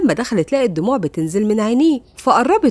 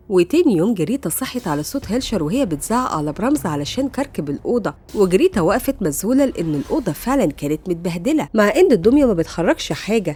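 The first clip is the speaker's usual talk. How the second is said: plain reading voice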